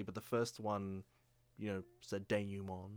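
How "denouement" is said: "denouement" said French-style, day-noo-mon; French pronunciation. Shown as a demonstration. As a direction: give "denouement" its French nasal ending. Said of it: "all pronounced it differently".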